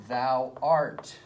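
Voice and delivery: "Thou art" with "art" hit harder; stress on art. Shown as a demonstration. Teacher shows accent on second word, stress on "art". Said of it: "putting the accent on the second word".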